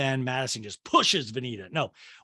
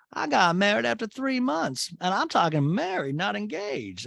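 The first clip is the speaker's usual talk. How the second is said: higher, emphatic mock matron; boastful mother impression in a raised voice; Patricia; 6 semitones higher than usual. Mother topping the engagement news, high emphatic imitation. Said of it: "Patricia says".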